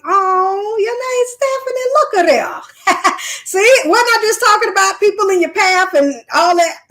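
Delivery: real amazement at the coincidence; a squealing, happy shock when a viewer named Stephanie appears; excited surprise. Real, not acted. Sees a commenter named Stephanie.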